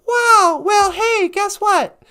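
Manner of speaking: high, loud burst of delight; excited. Acted. Friends learn the policeman shares their dream, excited. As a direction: pitch it as thrilling news.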